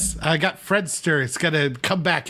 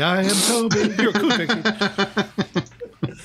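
slow, laughing sign-off line; laughing drawn out; 4 semitones higher, slower than usual. Acted. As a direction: stretch it out through laughter.

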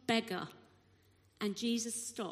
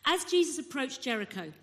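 usual speaking voice

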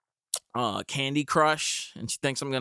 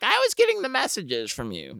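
put-on high old lady voice; elderly neighbor; 8 semitones higher than usual. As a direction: play the older neighbor lightly. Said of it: "she's like".

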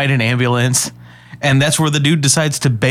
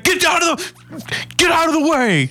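high mock yell at a car. Imitating the cop yelling at the blocking car. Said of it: "he's like".

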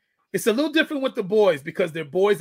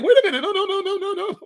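much higher, pleading mock protest; mock panicked kid; 12 semitones higher, 3 dB louder than usual. Kids told they're on their own, hammed-up pleading. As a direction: squeak out the no's like a panicking teen.

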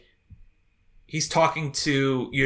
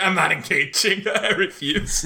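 laughing refusal; 3 semitones higher, slower than usual. Retold or imitated.

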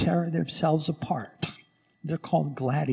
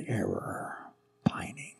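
whispered; 3 semitones lower, 9 dB quieter, slower than usual. Acted. Whispered hymn word.

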